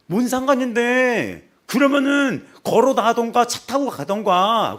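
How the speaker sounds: loud, high and slow, spitting each word; rude yelling. Retold or imitated. Woman yelling at the bus driver, slow loud rude shout.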